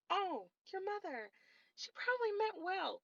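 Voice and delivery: lightly voiced high, soft mock viewer. To her viewers, soft high mock of their excuses. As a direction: mimic the naive commenters. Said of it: "I know y'all sitting here thinking".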